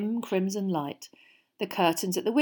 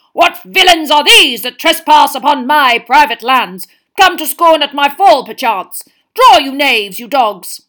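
loud, higher furious knight; angry knight; 7 semitones higher, 16 dB louder than usual. A narrator's reading. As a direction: roar a challenge like a tiny knight. Angry knight challenging intruders.

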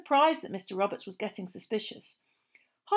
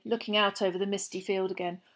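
plain reading voice

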